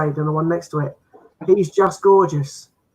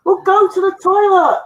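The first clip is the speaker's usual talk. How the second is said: silly high voice; 11 semitones higher, 4 dB louder, slower than usual. Acted. Joke misreading Shih Tzu.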